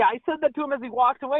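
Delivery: ordinary delivery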